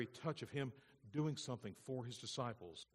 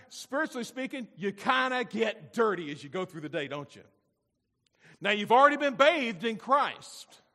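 loud, raised, rolling pulpit delivery; full-force preaching; 8 semitones higher, 13 dB louder than usual. Real, not acted. Preacher describing getting dirty through the day, loud and impassioned. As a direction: pile up the list with urgent force.

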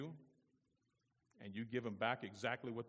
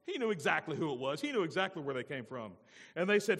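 usual speaking voice

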